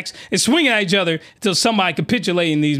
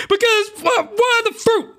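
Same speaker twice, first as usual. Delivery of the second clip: pissed-off rant; 13 semitones higher than usual. Real, not acted. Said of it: "Because now I'm pissed".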